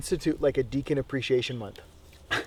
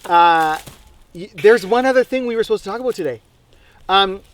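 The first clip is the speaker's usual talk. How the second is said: strained and louder after choking; recovering from choking; 5 semitones higher, 7 dB louder than usual. Real, not acted. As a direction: clear the throat and push on. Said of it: "sorry, I'm choking on a bit of the sugar".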